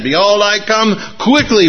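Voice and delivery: high-pitched, emphatic pulpit proclamation. Acted. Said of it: "with more oomph".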